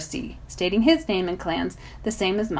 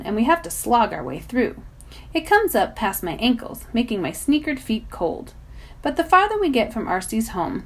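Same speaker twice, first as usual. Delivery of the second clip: ordinary delivery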